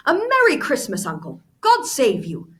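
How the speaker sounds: high, loud merry greeting; cheerful cry. Acted. Nephew greeting his uncle, cheerful loud cry. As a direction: sing out the holiday greeting. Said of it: "cried a cheerful voice".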